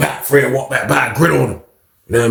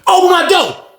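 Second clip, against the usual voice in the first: much higher mock demand; retold demand. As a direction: bark at the officer to open up. Old man demands door.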